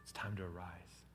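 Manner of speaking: hushed and tender; gentle whisper. Acted. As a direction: whisper "Little girl" softly. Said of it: "Jesus is in no hurry".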